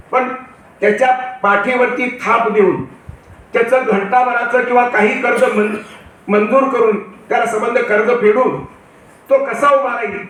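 ordinary talk, nothing marked

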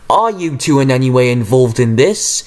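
usual speaking voice

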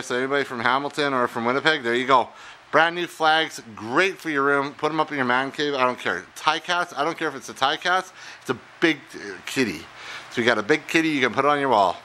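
usual speaking voice

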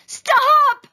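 put-on high, very loud child's yell; yell at full volume. A narrator's reading. Taya picked up by the ear, yelling. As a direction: yell it as loud as you can. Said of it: "Taya yelled as loud as she could".

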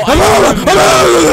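raging, drawn-out shout; divorced-father yelling. Retold or imitated. Teammate re-enacting his outburst over call outs, raging yell. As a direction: bellow like a dad who has lost it. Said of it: "You sound like a fucking divorced father".

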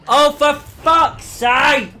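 high, loud, slow and drawn out; furious shout. Real, not acted. Gamer furious after dying again, furious shout. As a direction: let the rage burst out. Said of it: "I'm so angry right now".

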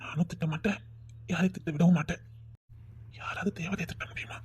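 soft whispered tenderness; husband whispering a sweet compliment to his wife. Acted. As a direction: breathe it to her like a secret.

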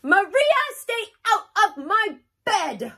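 loud, high scolding; telling off. Acted. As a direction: yell at little sister. Child scolds baby sister.

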